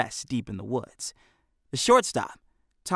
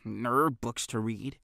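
muttered; 6 dB quieter, slower than usual. A narrator's reading. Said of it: "Hefty muttered".

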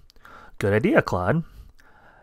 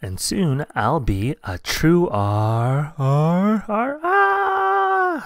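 rising into a cry; 5 semitones higher, 3 dB louder, much slower than usual. Acted. Mouse falls building to a cry.

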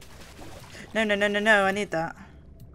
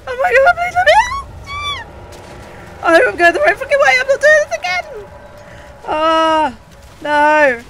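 panicked high screaming; terrified shrieks while fleeing; being chased; 8 semitones higher, 11 dB louder than usual. Real, not acted. I hate chase scenes.

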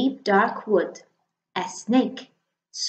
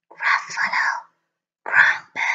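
spooky whisper. Acted. The mouse threatening the Gruffalo, a spooky whisper. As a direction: hiss it to scare the Gruffalo.

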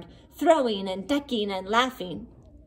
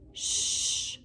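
soft unvoiced shush; whisper; 7 dB quieter than usual. A narrator's reading. Hughley hushes friends near the sleeping child, whispering. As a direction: hush them so the child sleeps. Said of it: "Hughley whispered".

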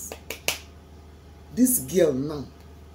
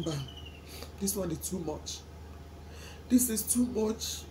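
holding back tears; 10 dB quieter than usual. Real, not acted. Fighting tears on camera.

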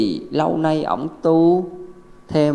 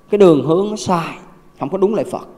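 ordinary talk

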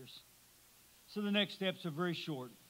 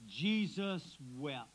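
"Jesus wept" drawn out very slowly and softer; solemn slow reading; 5 semitones lower, 5 dB quieter, much slower than usual. A narrator's reading. Preacher to the grieving, "Jesus wept" said very slowly. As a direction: let each word of "Jesus wept" hang, slow and hushed.

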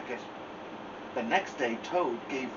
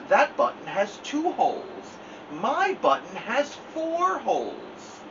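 higher, slow, insistent Toad; fussy Toad; 9 semitones higher, slower than usual. Acted. Toad correcting the sparrow, higher and slow. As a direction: lay it out slowly.

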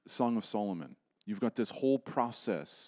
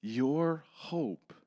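ordinary delivery

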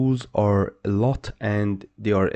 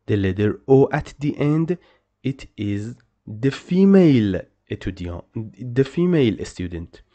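normal talking voice

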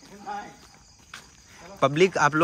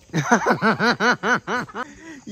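a long run of laughter; real laughter; laughing; 4 semitones higher than usual. Real, not acted. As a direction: laugh at the mess outside. Laughing at the pit scene.

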